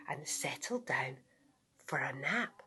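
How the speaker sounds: soft sleepy whisper. A narrator's reading. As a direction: drift off into a whisper. Sleepy narration.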